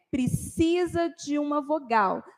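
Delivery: usual speaking voice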